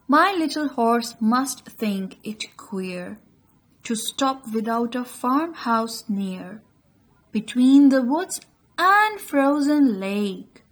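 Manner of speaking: slow, measured verse reading with lifted phrases; poem recitation. Shown as a demonstration. Teacher shows students how to read the poem, expressive recitation. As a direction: recite line by line, pausing between phrases.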